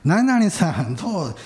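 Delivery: high, drawn-out friendly hail; imitated old woman's greeting. Old woman hails him by the wrong name again, imitated high sing-out greeting. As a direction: call out like a chatty old lady, stretching the words. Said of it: "みたいな感じで".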